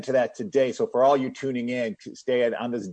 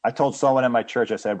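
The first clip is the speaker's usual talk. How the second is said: ordinary talk, nothing marked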